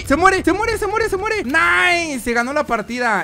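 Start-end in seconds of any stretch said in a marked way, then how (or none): 0.0-2.2 high, rapid excited chant; real excitement. Real, not acted. Gamer watching an enemy about to die, excited. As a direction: cheer on the kill, pitched up.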